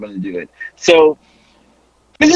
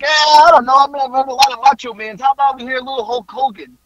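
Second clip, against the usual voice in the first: imitating a higher, quick, reluctant groan; reluctant politeness; 6 semitones higher, faster than usual. Panther answering the fan, reluctant but feeling bad. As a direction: humour them though you don't want to hear it. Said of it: "he says it as if he doesn't wanna hear it, but he feels bad".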